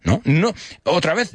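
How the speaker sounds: ordinary talk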